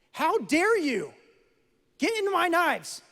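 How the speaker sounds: high, loud, slow outraged yell; mock furious parent. Pretend parent scolding the bleeding son, high loud slow outrage. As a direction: blast the kid in hammed-up outrage. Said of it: "No, that's ridiculous".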